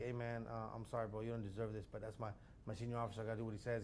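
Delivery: low, soft confiding voice; retold apology. Junior cop apologising to the driver, retold low soft voice. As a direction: imitate an apology made on the side, quietly.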